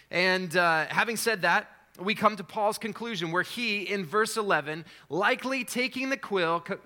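normal talking voice

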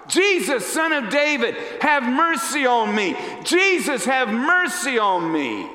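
high, loud pleading shout; crying out. Acted. Blind man calling Jesus over the crowd, desperate shout. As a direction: shout it at the top of your lungs. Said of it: "at the top of his lungs, he cries out".

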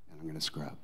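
hushed Jesus resolve; low hushed vow. Acted. Jesus voiced, repeated softer.

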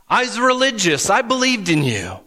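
high, pleading protest; pleading believers. Retold or imitated. People at judgment day pleading with Jesus, high and urgent. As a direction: beg to be let in. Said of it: "they're gonna say".

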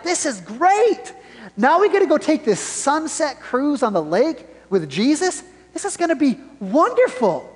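high, excited voice of happy disciples; mock delight.

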